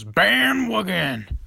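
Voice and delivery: loud, high, drawn-out mock movie yell; Jim Carrey. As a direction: stretch it out like an over-the-top comedy catchphrase. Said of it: "in that movie, he goes".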